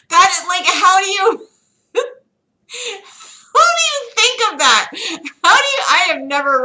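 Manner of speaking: high, loud, through laughter; laughing disbelief. Real, not acted. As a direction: squeak it out between laughs.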